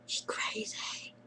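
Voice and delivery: breathy secret whisper, barely audible; whispered aside. Real, not acted. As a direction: let it slip in a whisper to the camera. Aside about her sister.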